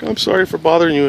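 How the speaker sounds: lightly acted tearful apology; retold upset sister signing off; imitated crying caller. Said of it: "she's crying very hard".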